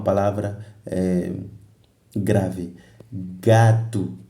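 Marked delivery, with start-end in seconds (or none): 3.4-4.2 "Gato" with a strong GA, falling away; teacher's demo of GA-to; stress on first syllable. Shown as a demonstration. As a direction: punch the GA in "Gato". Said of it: "ga é a sílaba tônica".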